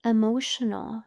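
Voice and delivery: slow, measured meditation voice with long pauses; guided meditation. Acted. Teacher guiding listeners to notice their breath, calm meditation voice. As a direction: guide them unhurriedly through each breath, pausing between phrases.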